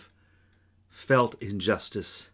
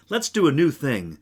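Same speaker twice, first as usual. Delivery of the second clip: higher, louder, an eager proposal; mild excitement; 6 semitones higher, 6 dB louder than usual. Acted. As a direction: voice a bright idea with a lift. Imagined quote of God, lively.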